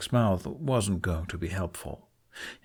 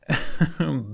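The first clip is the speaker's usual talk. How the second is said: raised, delighted laugh; a spinning, helpless laugh; bright, raised outburst of laughter; burst of laughter; 6 semitones higher than usual. Acted. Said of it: "burst into laughter".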